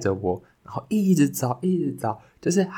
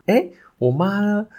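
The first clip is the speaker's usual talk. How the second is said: put-on scared little boy; 11 semitones higher than usual. Lost child notices mother gone.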